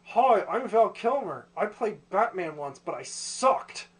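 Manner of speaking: mocking stiff-jawed impression, drawn out; Val Kilmer. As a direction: talk slowly as if the jaw won't move. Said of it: "why does he talk like".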